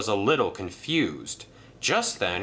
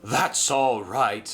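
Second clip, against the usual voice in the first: laughing; slower than usual. Acted. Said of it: "laughed Merlin".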